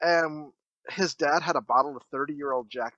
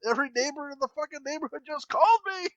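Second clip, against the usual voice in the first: fast, higher mock rant; angry dad; 11 semitones higher, faster than usual. Dad scolding teens at dawn, fast higher mock rant. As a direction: rattle it off like a furious father. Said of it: "He's like".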